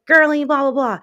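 high, loud mocking singsong; joking imitation. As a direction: mimic him teasing back, pitched well up. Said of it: "saying it back to me as a joke".

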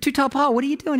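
calling out. Retold or imitated. Calling out to friend.

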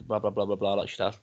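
normal talking voice

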